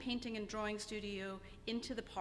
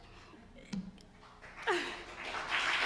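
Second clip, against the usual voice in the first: breathless teary laugh, higher; 8 semitones higher than usual. Real, not acted. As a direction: laugh through rising tears. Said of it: "I'm gonna cry".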